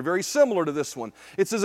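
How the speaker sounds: ordinary talk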